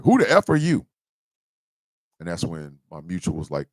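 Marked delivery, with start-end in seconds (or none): 0.0-0.9 high, loud mock outrage; imitating an angry guy's yell; angry retort. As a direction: snap it like an offended stranger. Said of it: "the guy's like".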